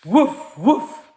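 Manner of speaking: short, loud woofs; dog bark. A narrator's reading. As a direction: bark out two sharp woofs. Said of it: "barked the dog".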